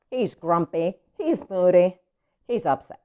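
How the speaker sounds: usual speaking voice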